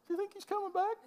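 high, soft, half-laughing question; a naive follower still looking up; hopeful disciple. Retold or imitated. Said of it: "the next guy looks up".

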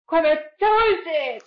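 put-on high, fast, excited childish gush; childlike voice. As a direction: squeal it like a little kid. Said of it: "子供みたい". (子供っぽくはしゃいで)

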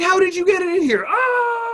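high fast shrieking yell; girl yelling at guy in her room; shocked yell. Retold or imitated. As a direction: shriek at the intruder.

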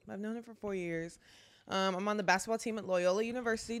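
ordinary talk

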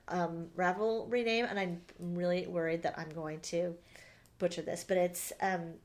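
ordinary delivery

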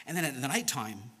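usual speaking voice

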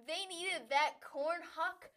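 ordinary talk

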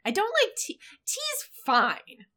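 high-pitched defensive protest. Real, not acted. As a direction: squeak out the confession.

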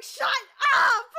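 very loud, high exasperated yell; screaming. Retold or imitated. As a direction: scream it to cut off a gushing friend. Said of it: "probably hearing me screaming".

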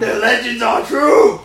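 loud, slow, hammed-up menace; mock villain. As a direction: play a madman villain for laughs. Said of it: "we're taking this bit too far".

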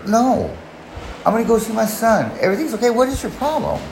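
high-pitched retold argument; exasperated refusal. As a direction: push back in a high, exasperated voice. Retold argument over leaving.

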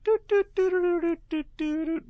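high sing-song doot doot; imitated doot sound. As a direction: chant the doots high and loud. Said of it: "him doing the doot, doot".

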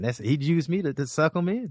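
ordinary delivery

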